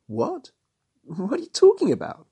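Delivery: high, stunned disbelief; rising in shock at the accusation; astounded. Acted. Mariana, suddenly accused of a crime, astounded. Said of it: "Mariana looked truly astounded".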